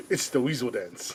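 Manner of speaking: normal talking voice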